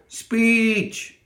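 very high, held crowd call; high-pitched gremlin chant. A narrator's reading. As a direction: squeal it out as a crowd. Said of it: "the gremlins called".

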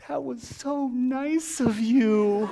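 high, slow mock-crying voice; drawn-out words through pretend tears; imitated tearful child. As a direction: act a little kid overwhelmed and weeping. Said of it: "through the tears".

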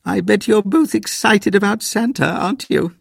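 put-on high, sing-song granny to kids. A narrator's reading. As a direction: coo at the children. Granny to children.

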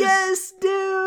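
very high, loud exclaim; excited. Real, not acted. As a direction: yell it in delight. Delighted credit reveal.

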